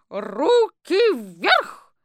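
full-voice shout. Acted. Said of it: "закричала во весь голос". (крик во весь голос, медленно и высоко)

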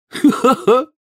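high acted laugh. A narrator's reading. Boy laughs after asking for a team place, gleeful laugh. Ron jokes about joining team.

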